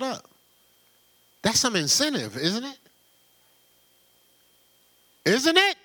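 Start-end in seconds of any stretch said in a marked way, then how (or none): 5.2-5.8 demanding a response, pitched high; insistent louder repeat. Real, not acted. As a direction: call it out to the room. Question asked twice to congregation.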